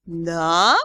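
low questioning rise, mildly surprised; intrigued, curious question. A narrator's reading. Ghost hearing gossip about the dragon, surprised. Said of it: "Дара удивлённо приподняла брови".

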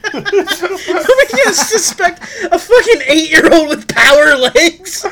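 high, loud, laughing disbelief; cracking up while asking it; laughing incredulous. Real, not acted.

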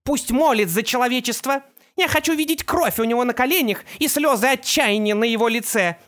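high, imperious villainess; Opal voice. A narrator's reading. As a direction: command with gloating cruelty.

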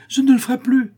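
very high, loud panicked cry; terrified boy crying out to his master; desperate cry. Acted. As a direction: scream the promise in terror. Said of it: "Le pauvre petit criait".

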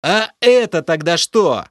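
loud, high angry yell. A narrator's reading. Hero yells at Lokhar, pointing at the fish, loud. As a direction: bellow it in fury. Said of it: "заорал я".

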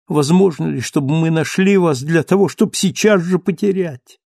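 high, anguished pleading; choked with sobs. A narrator's reading. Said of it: "но рыдания душили её".

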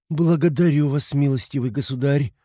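high-pitched, lofty formal thanks; haughty. A narrator's reading. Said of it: "начала она свысока". (свысока, высоким церемонным голосом)

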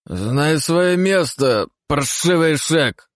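put-on high, slow, drunken slurring snarl; slurred drunk hiss. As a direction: slur it out like a drunk. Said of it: "заплетающимся языком прошипел Осман".

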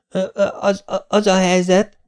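put-on high, halting boy's stammer; dadogás. A narrator's reading. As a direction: stumble over the words, stuck for an excuse. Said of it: "dadogta Dénes". (magas hangú, akadozó dadogás)

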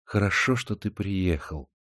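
soft, fading whisper. A narrator's reading. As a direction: whisper it tenderly. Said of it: "она прошептала".

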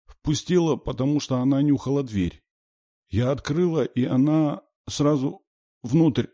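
put-on high, plaintive little-girl voice; high, small, upset child; child near tears. A narrator's reading. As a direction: tell it haltingly, almost crying. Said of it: "Девочка едва не плакала".

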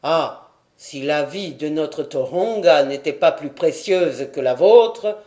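fierce regretful cry. A narrator's reading. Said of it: "s'écria Keikumu".

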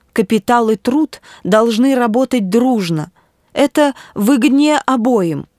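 high-pitched, pleading moral appeal; earnest agitated bishop. Acted. Bishop's agitated plea in narration. (взволнованный епископ, с мольбой)

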